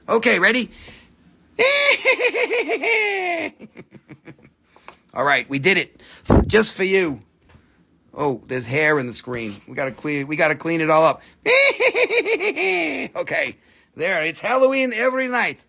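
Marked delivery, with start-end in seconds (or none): 1.6-3.8 high cackling witch laugh; a high witchy cackle for the flyby. Acted. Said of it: "Time for a witch flyby". 11.4-13.1 high witchy cackle; witch cackle. Acted. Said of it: "Time for a witch flyby".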